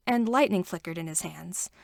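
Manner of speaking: ordinary delivery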